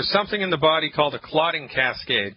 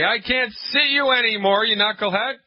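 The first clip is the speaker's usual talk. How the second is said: high-pitched, heated mock tirade; indignant patient; 7 semitones higher than usual. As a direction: play a fed-up patient firing the doctor. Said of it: "I want her to say".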